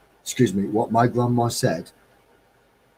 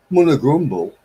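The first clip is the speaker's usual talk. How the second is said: munna grumble, said broad and louder; Midlands dialect; 4 semitones higher, 5 dB louder than usual. Shown as a demonstration. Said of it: "where I come from".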